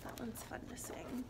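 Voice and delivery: soft unvoiced aside; whisper. Real, not acted. Host whispers an aside to the children. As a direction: lean in and whisper it.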